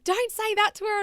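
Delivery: high, loud imitated telling-off; retold yelling. Nan yelling at Pop from the veranda, retold yell. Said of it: "yelling at him".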